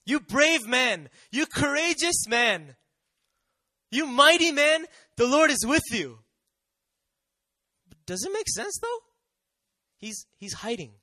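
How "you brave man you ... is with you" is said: loud, high, drawn-out angelic greeting; booming proclamation. Acted. Angel greets hiding Gideon as a hero, loudly proclaiming. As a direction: proclaim it like a herald, big and slow.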